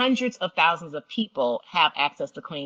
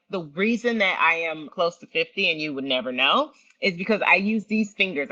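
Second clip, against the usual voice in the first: ordinary delivery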